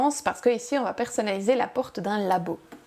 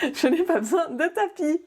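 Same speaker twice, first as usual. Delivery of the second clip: high, laughing through the words; breaking into a light, higher-pitched laugh; amused laughing; 7 semitones higher than usual. Real, not acted. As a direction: laugh at your own little joke. Jokes about smart vinyl, laughs.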